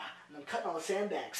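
ordinary talk

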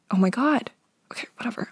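hushed annoyed aside; whispering. Real, not acted. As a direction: whisper it to yourself. Whispered self-aside after losing thread.

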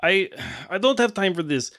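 put-on impatient villain with a foreign accent; hooded villain. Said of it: "the hooded figure notices that you're still there and is like".

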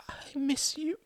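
tearful. A narrator's reading. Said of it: "because she was crying".

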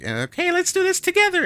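very high, cutesy anime maid voice; squeaky sing-song waitress cheer. Retold or imitated. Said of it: "the lowly voice is like".

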